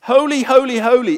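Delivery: each holy loud and high, falling; holy in triplicate, loud and high; emphatic triple holy. Shown as a demonstration. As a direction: ring out holy, holy, holy. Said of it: "It's in triplicate".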